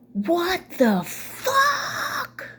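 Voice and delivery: high, screechy, drawn-out imitated yell. Mother outraged at being rebuffed, mock yelling. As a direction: mimic an outraged shriek, stretching each word. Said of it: "She yelled".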